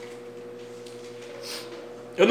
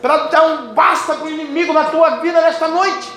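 raised, high-pitched sermon cadence; full-force preaching; 9 semitones higher, 8 dB louder than usual. Real, not acted. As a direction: proclaim it fervently to the congregation. Prega com força total. (pregação fervorosa com voz elevada)

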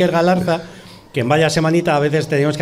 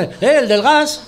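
calling out to him; 9 semitones higher, slower than usual. Retold or imitated. Strangers recognising him on street.

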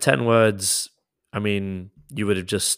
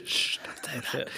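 jokey whispered shush; hushed, conspiratorial whisper; mock hushing; 4 semitones higher, 8 dB quieter than usual. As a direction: whisper it like a secret to be kept. Hushing a co-host's spoiler, joking.

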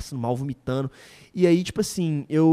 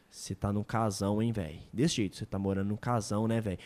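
low quiet stranger; 9 semitones lower, 8 dB quieter than usual. Retold or imitated. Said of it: "Ele olhou assim".

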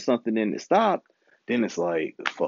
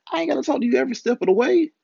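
higher and fast, peaking on "way"; fast exasperated rant; 3 dB louder, faster than usual. Retold or imitated. Retelling what he told his woman, fast and much higher. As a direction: rattle "every step of the way" off quickly and fed up.

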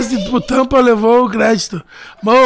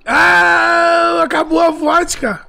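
hammed-up crying shout, slow; mock wail; 7 semitones higher, much slower than usual. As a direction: wail it like a tragedy. Mock wail over vodka.

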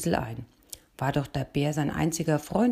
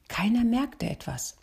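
plain reading voice

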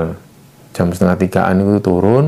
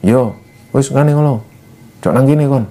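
put-on a touch of a cross old man, a little higher; imitating a gruff villager's scolding, understated; old man scolding the lost hikers, mildly angry; mild mock anger; 4 semitones higher than usual. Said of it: "Sambil marah gitu loh".

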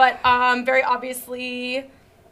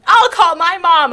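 louder, higher hammed-up threat; childish yell; 4 semitones higher, 8 dB louder than usual. Captive threatening her kidnapper, mock childish yell. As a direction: yell it like a kid tattling. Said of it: "I yell".

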